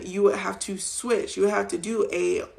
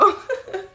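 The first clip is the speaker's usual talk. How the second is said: high giggle while tickled; tickled laugh; 13 semitones higher than usual. Real, not acted. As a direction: squeal with laughter. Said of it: "Stop tickling me".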